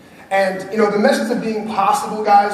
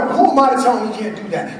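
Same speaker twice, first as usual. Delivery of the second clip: usual speaking voice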